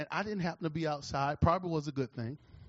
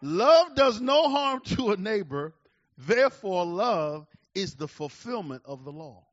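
preaching at full force; 3 semitones higher, 9 dB louder than usual. Acted. Loud preached scripture.

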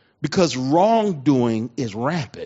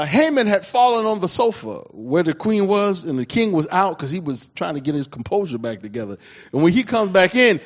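usual speaking voice

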